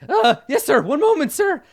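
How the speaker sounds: put-on high, loud, flustered old skeleton; flustered wizard. Skeleton wizard answering his commander, high flustered yell. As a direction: call back in a high fluster.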